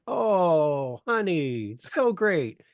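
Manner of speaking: high, patronizing mock sweetness; imitating a condescending old hand; condescending coo. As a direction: talk down to him like a cute newbie. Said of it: "And they're like".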